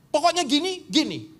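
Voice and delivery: stubborn leader. Retold or imitated. Pastor overruling protesting leaders, high and loud. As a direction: shut the argument down.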